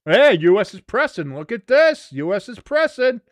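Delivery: high, loud repeated alert; excited match call. Real, not acted. Commentator alerts listeners that U S presses, excited high call. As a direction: build excitement as the team pushes up. Calling an attack live.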